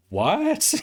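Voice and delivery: high-pitched, incredulous squeak; mind-blown disbelief. Acted. Acting out a mind blown by a big idea, astonished. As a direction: let the voice leap up in mock astonishment.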